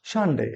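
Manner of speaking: সানডে as the teacher's model, falling at the end; corrected Sunday. Shown as a demonstration. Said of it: "কি বলবো?". (সানডে-র সঠিক উচ্চারণ দেখানো)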